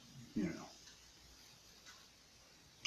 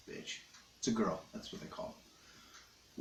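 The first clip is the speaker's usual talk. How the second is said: whispered aside; 3 semitones lower, 14 dB quieter than usual. Acted. Aside about the dog.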